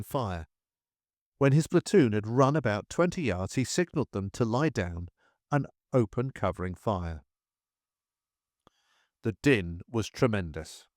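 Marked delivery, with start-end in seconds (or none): none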